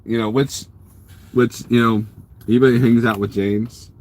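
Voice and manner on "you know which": ordinary talk, nothing marked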